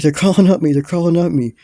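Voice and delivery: high, loud, frantic retelling; panicked. Retold or imitated. Teller scratching his legs mid-trip, panicked. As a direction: act out rising alarm on the repeat. Acts out hallucination of crawling things.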